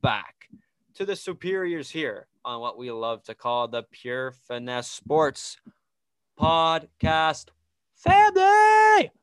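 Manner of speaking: booming circus ringmaster intro. Acted. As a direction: announce it like a big top show. Circus-style show opener.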